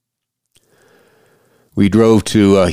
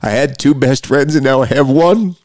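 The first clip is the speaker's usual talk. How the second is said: tearful; 6 semitones higher, 3 dB louder than usual. Real, not acted. Grieving man telling listeners of losing his best friend, tearful. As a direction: voice rising high with grief. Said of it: "my cry".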